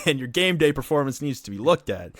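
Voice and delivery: fired-up, forceful telling-off; heated rant. Real, not acted. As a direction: lay into them for their excuses. Heated rant about teams' excuses.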